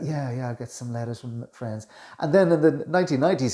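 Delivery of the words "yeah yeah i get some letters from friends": softer, laid-back imitation of a seventies rock star; lazy, hushed mock drawl. Coach imitates the singer's old interview manner, softer. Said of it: "quite talking like that".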